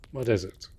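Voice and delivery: sudden surprised outburst; startled. Acted. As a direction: jolt out of calm in surprise. Startled by listener's action.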